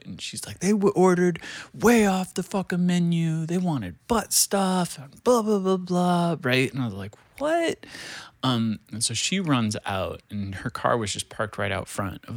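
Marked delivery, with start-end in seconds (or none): 0.6-6.3 high, frantic retelling of the escort; imitating a panicked woman going off; flipping out. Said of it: "just flipping out". 7.4-7.8 sudden very high squeak of disbelief; shocked. Retold or imitated. Hearing shocking news at work.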